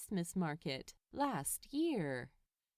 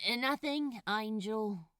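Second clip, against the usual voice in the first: mildly raised call back; 3 dB louder than usual. A narrator's reading. Crowley answering the angel from the sofa, mild call. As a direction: call back across the flat. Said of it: "he called back".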